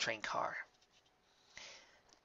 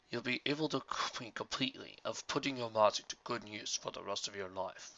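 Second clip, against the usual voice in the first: put-on wise old mentor; 3 dB quieter than usual. A narrator's reading. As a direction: read the author's farewell gravely. Book's author addresses reader.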